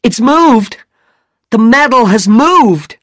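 high, loud cry of alarm; excited shout. A narrator's reading. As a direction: shout the startling news to everyone. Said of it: "Regan shouted".

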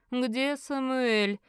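very slow, drawn-out question; imperious old sorcerer. Acted. Merlin asks his son about Samuel, slow and imperious. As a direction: stretch each word with cold authority.

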